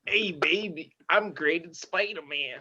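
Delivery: high, nasal sleazy showman; slow, nasal come-on in a put-on high voice; Stan Lee impression. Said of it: "that kinda nasal voice in a way, but high pitched".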